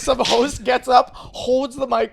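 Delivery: slow dramatic build. Acted. Dramatic mock mic moment.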